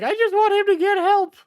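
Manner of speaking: high, loud mocking imitation of her; mocking impression. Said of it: "I hate how she's like".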